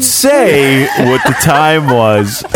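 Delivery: high, slow mocking voice; hammed-up whiny imitation; mocking quote. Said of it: "You gotta say".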